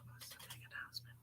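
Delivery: soft unvoiced whisper. Real, not acted. Asking the spirit again privately, whispered. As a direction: ask it again on breath only. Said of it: "repeated after plain ask".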